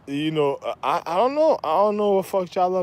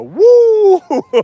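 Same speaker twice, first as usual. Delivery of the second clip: high, loud, held "woo"; imitated falsetto whoop; 12 semitones higher, 8 dB louder than usual. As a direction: mimic a pop singer's piercing whoop. Repeating the [name] whoop.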